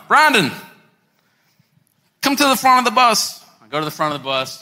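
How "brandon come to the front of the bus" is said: raised, imitated summons from a leader; Doctor Don. Pastor summoning the preacher to the bus front, calling out. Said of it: "I hear my pastor's voice, Doctor Don".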